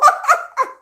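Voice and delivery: loud, high laugh; real amused laugh; high burst of laughter. Real, not acted. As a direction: laugh out at the absurdity. Laughing at the stalker's texts.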